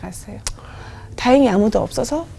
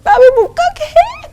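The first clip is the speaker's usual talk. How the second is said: very high, loud, slow wailing scream; tantrum scream; 7 dB louder, slower than usual. Retold or imitated. Wife throwing a tantrum at God, screaming very high and slow. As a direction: stretch each word into a childish tantrum. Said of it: "떼부를 부린 거예요".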